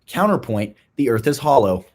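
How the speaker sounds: ordinary talk, nothing marked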